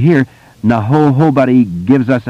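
stammered hobody, catching on ho; stuck on ho- before hobody; stuttering. A narrator's reading. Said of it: "suddenly and defiantly".

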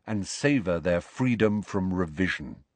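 ordinary delivery, nothing marked

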